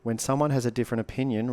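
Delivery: ordinary talk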